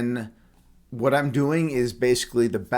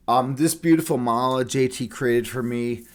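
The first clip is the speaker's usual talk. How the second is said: ordinary talk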